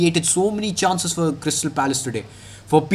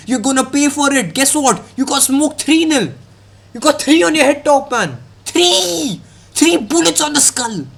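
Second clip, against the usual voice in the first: rising, higher, heated taunt; building rant; 9 semitones higher than usual. Real, not acted. Mocking Palace players after the loss, a high, rising taunt. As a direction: climb higher with each jab, peaking on "Three!".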